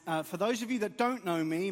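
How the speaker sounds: ordinary delivery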